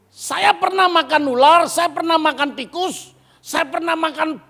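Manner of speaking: loud, high preaching build; rising preacher's listing. Real, not acted. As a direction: shout out each thing eaten.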